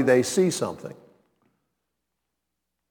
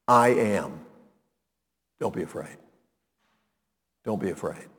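reassuring Jesus; 7 semitones lower, 6 dB quieter than usual. Acted. Jesus calms terrified disciples.

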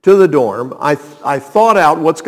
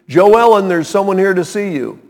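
calling out; 6 semitones higher, 5 dB louder than usual. Retold or imitated. Clerk calls upstairs on intercom.

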